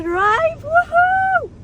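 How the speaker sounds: very high, loud whoop of excitement; excited squeal. Real, not acted. Girl allowed to drive the truck alone, excited squeal. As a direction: build into a squealing cheer. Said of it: "I'm so excited".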